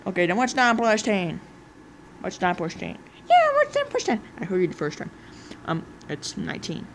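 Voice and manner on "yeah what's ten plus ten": very high squeaky mocking voice; mock them in a squeaky voice. Squeaky voice again.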